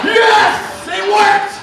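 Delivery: loud, high-pitched cheer; triumphant shout. Real, not acted. Comic celebrating his joke landing, triumphant shout.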